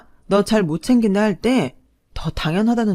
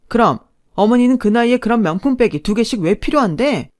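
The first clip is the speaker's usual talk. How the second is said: louder, higher, cutting rebuttal; angry retort; 5 semitones higher, 6 dB louder than usual. Acted. Wife snapping back at husband in a money fight. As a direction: hurl the question back at him.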